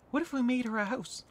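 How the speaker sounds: ordinary talk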